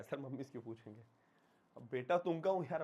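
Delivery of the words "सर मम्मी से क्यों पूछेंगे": a student asking quickly, voiced softly; lightly playing a curious pupil, hushed and fast; a hint of a cheeky student, softer and quick; quick, quiet mock student question.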